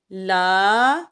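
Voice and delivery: لا held long in recitation; long laa. Shown as a demonstration. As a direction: stretch لا like a reciter. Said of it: "اس کو ہم لمبا کر رہے ہیں لا".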